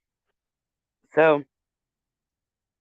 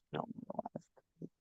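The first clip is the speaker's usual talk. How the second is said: breathy whispered aside; whispering; 21 dB quieter than usual. Real, not acted. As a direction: whisper the punchline.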